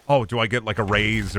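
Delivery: usual speaking voice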